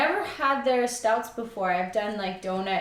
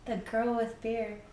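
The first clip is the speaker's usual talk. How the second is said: soft aside; 2 semitones higher, 5 dB quieter than usual. Real, not acted. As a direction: toss the name off quietly. Names her channel twice.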